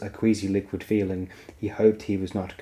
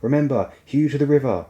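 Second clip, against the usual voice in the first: higher, louder battle command; shouted order; 5 semitones higher, 4 dB louder than usual. Acted. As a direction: bark it across the galloping line. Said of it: "he shouted at his clansmen".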